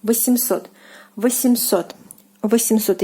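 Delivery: восемьсот with a hard M, "va-sem-SOT"; восемьсот, M not softened. Shown as a demonstration. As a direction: repeat восемьсот, M kept hard. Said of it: "the soft sign, it doesn't soften".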